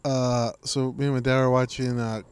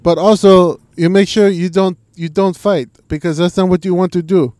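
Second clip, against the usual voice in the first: much higher and much louder; loud preachy advice; 6 semitones higher, 11 dB louder than usual. Retold or imitated. GSP giving bar-fight wisdom, loud and high. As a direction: declaim the wisdom at full volume. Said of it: "He's like".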